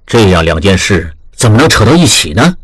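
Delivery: grave elder master. A narrator's reading. Master questions his apprentice.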